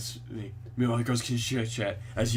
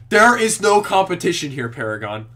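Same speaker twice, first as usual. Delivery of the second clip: blunt declaration; 5 semitones higher, 7 dB louder than usual. Acted. Player character's blunt line.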